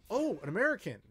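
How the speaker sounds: bright, lifted surprise at meeting an American; excited. Retold or imitated. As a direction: act pleasantly surprised. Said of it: "like, excited".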